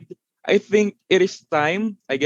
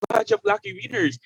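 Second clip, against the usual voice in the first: excited host; 3 dB louder than usual. Acted. Host building raffle suspense.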